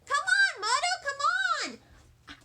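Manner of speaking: put-on high, loud urging kid voice; eager child pulling at mother; pleading little girl. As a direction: tug mom along impatiently. Junie tugging mother's arm.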